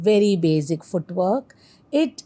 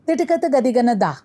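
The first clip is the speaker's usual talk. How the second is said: "Ti, ta, ka" rattled off rapidly, higher in pitch; quadruple tempo; 4 semitones higher, much faster than usual. Shown as a demonstration. Teacher showing double of still that, fastest tempo. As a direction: run all syllables together in one quick burst. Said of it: "next is double of still that".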